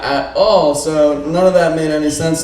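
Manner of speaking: loud, high MC hype voice; announcer. Real, not acted. Host hyping the crowd after the set, announcer style. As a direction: pump up the crowd for the next act.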